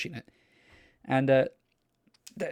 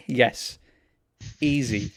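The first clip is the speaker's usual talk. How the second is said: ordinary delivery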